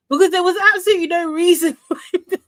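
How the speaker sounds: high, fast and laughing; gleeful disbelief, breaking into laughter; giggling it out quickly. Real, not acted. Laughing at Chris's pettiness.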